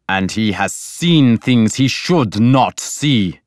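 slow, grim accusation; angry. A narrator's reading. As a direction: stress it slowly, with contained anger. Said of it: "but he was angry now".

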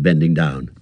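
usual speaking voice

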